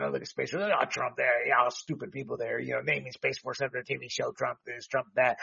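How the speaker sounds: softly mocking the people the show has fooled; mock viewer voice. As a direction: hush it down and mock the gullible viewer. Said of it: "That's what she's trying to impress".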